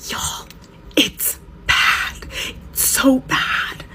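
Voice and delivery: silent mouthed words, barely breathed. Retold or imitated. Mom mouthing to her livestream viewers, voiceless whisper. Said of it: "she mouths to the live".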